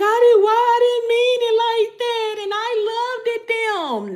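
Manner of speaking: high, loud whiny mock plea to the spirits; mock panicked plea. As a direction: squeal it like you never meant it. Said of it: "you be like".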